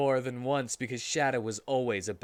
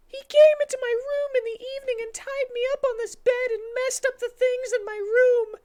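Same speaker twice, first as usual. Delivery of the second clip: put-on fast, squeaky high girl voice; high Amy voice; 8 dB louder than usual. Amy tells Sonic what happened, fast squeaky voice.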